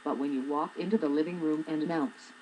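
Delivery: normal talking voice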